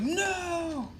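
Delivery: a sudden high cry of no, voice jumping way up; horrified no. Acted. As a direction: Carnage cries out as he sees the snap, horrified and high. Carnage sees the snap.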